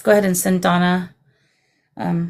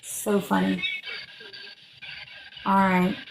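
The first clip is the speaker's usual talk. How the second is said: ordinary talk, nothing marked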